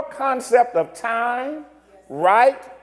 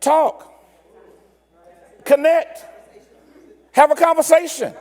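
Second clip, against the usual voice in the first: a hint of a patient host explaining the meal; insisting waiter. The waiter tells the American to sit and talk, lightly voiced. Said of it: "they're like".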